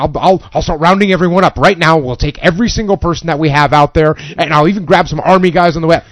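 loud, fast, sarcastic overkill offer; mocking overkill. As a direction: blow the request up out of all proportion, loudly. Boss mocking by exaggerating request.

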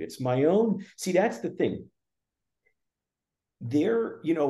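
ordinary talk

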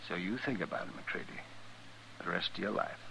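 low, soft, threatening hush; quiet menace. Acted. Doctor coldly warning MacReady, quiet and menacing. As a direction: let it sink in, barely above a breath.

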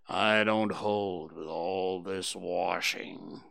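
low, slow gloomy donkey grumble; grumbling Eeyore. A narrator's reading. Eeyore grumbles to the others about washing, low and slow. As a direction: drag it out under your breath, disapproving. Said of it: "grumbled Eeyore".

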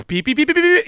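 high, loud robotic beeping; a high, loud imitation of a robot beeping; robot beeps. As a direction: beep it out like a machine. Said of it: "turned himself into a fucking robot".

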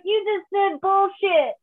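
high, slow, loud gleeful reveal; laughing aunt. Retold or imitated. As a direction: imitate an aunt cracking up at her prank.

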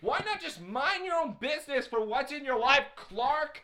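high-pitched, swooping rant at him; worked-up, indignant dressing-down; exasperated scolding. Real, not acted. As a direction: tell him off in comic outrage. Scolding the character Clark.

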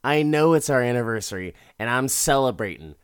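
mock bitter husband, loud and quick at first; passive-aggressive. Drinking husband answers wife on their anniversary, passive-aggressive. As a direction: burst out pointedly, then settle into the jab. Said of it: "it never loses steam in the passive-aggressive nonsense".

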